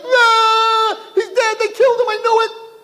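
very high, hammed-up horror; panicked screaming. Twin in the womb hears his brother scream, panicked shrieking. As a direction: shriek as if your worst fear came true.

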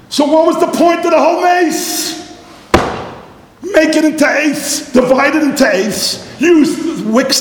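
excited exclaiming. Acted. Teacher pressing students on why they needed a miracle. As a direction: throw the question out with rising excitement.

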